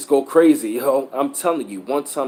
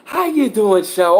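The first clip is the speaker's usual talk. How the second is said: mock church lady greeting; 8 semitones higher, 6 dB louder, slower than usual. Church member greets with bad breath.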